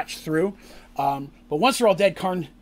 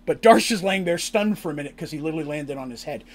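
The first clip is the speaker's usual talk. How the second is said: ordinary delivery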